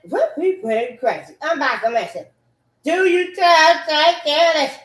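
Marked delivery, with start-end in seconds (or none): 2.8-4.8 high, loud yell; shouted outburst. Real, not acted. Speaker bursting out with the stock phrase, shouting.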